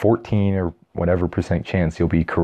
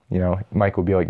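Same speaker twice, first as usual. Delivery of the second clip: normal talking voice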